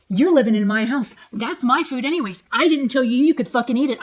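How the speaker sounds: loud, heated retort in her own voice; imitated quarrel, loud and insistent; retold angry dad. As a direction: re-enact a father laying down the law.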